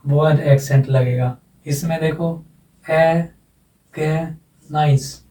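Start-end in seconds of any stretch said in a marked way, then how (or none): 2.9-5.2 "ए, गे, nice" split into three separate syllables. Shown as a demonstration. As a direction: break "ए, गे, nice" apart with clear pauses. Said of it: "तीन syllable है".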